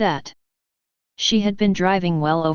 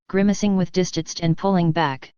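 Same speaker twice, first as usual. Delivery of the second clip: ordinary delivery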